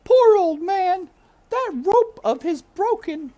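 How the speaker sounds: high loud cook's lament; shouting cook. A narrator's reading. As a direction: cry it out like a frantic old cook. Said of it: "shouted Cook".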